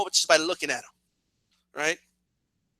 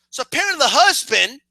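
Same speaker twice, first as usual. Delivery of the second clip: normal talking voice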